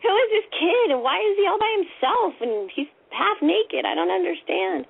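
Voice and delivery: high-pitched, worried questioning; alarmed bewilderment. Retold or imitated. Kid's alarm while running away.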